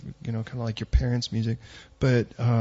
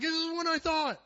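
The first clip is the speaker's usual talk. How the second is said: high squeaky quote; 5 dB quieter than usual. Retold or imitated. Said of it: "he's like".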